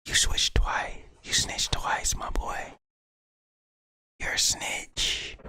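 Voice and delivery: taunting whisper to a snitch; hushed, teasing whisper; mock whisper. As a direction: whisper the accusation, slow at the end. Mock whisper aimed at the accused.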